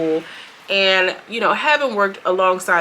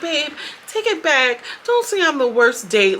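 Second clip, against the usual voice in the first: put-on pleading girlfriend; 8 semitones higher than usual. Retelling her pleading.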